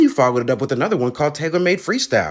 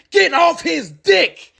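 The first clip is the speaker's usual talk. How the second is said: slow furious yell, higher; angry shout; 13 semitones higher, 4 dB louder, much slower than usual. Acted. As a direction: bellow it slowly at full force. Angry rant about rival.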